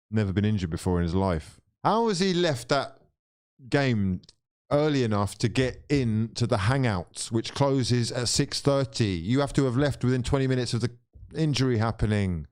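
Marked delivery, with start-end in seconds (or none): none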